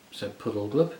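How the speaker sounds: ordinary delivery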